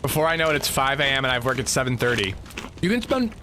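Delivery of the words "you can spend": talking while eating; mouth full. Real, not acted. Streamer apologizing to viewers for being rude, real mouthful. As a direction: speak round a mouthful of food. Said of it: "talking with my mouth full".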